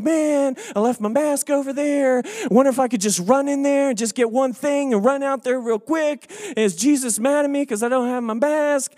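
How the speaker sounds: high-pitched, hammed-up worry; mock fretting. Shopper who left his mask behind, mock fretting. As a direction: fret aloud in a mock panic.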